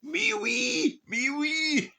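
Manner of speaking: very high, loud mock-French squeal; hammed-up falsetto French outcry; French going nuts. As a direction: squeal it like a frantic cartoon Frenchman. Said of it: "The bloody French will be going nuts".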